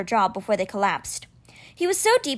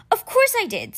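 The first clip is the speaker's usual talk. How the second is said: annoyed; 11 semitones higher than usual. A narrator's reading. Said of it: "Scene said, annoyed".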